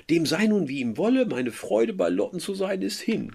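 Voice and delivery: high, loud, lamenting Werther quote; dramatic reading. Acted. Teacher reading Werther's letter aloud, lamenting. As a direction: read it as a lovesick complaint.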